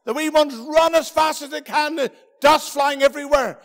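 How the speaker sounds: ordinary delivery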